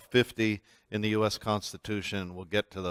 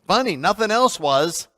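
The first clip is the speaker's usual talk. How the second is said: sarcastic quip, pitched up and louder; sarcastic retort; 8 semitones higher, 4 dB louder than usual. Retold or imitated. Teller to the park guide, sarcastic. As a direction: snap back a dry, sarcastic comeback. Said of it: "I'm going".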